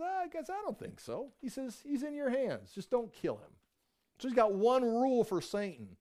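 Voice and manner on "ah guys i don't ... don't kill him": much higher, easy-going voice for God; God's voice. Retold or imitated. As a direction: play God lightly brushing off the accusation.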